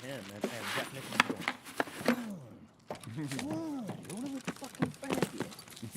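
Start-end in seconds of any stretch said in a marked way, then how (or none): 2.1-6.0 high, drawn-out movie impression; mock coaxing. Man sending the dog back to its owner, mock coaxing. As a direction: coax the dog slowly in a high voice.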